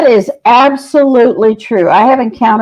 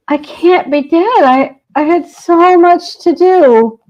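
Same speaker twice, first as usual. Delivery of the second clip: higher, pleading, a touch of breaking; distressed disbelief; 6 semitones higher, slower than usual. Retold or imitated. Dead producer begs the psychic, distressed and higher. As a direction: imitate her anguished protest, slowing down.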